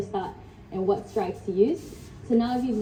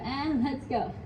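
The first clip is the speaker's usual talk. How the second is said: ordinary delivery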